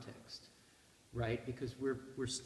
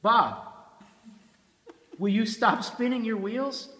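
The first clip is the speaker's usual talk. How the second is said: loud, higher, fed-up demand; mock impatient outburst, loud and raised; exasperated plea; 7 semitones higher, 11 dB louder, slower than usual. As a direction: snap at a rambling friend to get on with it. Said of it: "you wanna say".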